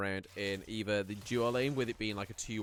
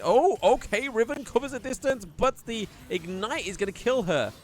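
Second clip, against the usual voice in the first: high, loud burst of live play-by-play; excited commentary; 11 semitones higher, 7 dB louder than usual. Real, not acted. Caster calling a sudden kill to viewers, excited and high. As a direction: call the sudden fight with real excitement.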